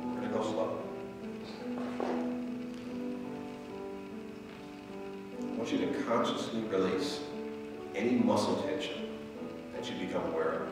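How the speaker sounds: slow, soft meditation voice; guided meditation. Acted. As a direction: guide them into stillness, slowly.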